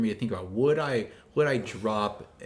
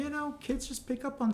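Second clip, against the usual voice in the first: high mock voice; 3 dB quieter than usual. Voice shifted high.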